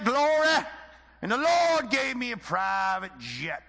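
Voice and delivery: high, loud Southern revival-preacher drawl with stretched vowels; Southern preacher accent. Retold or imitated. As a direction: holler it slowly like a Southern televangelist imitation. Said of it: "I'm gonna use the Southern accent".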